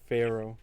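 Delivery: "Pharaoh", with a FAIR sound at the start; alternative Fearow pronunciation. Shown as a demonstration. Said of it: "grew up calling it".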